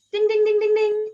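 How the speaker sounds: high rapid "ding" chant on one pitch; imitated bell ringing. Visitors ringing the bell on her desk, imitated high. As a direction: mimic an insistent little desk bell. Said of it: "visitors would come and go".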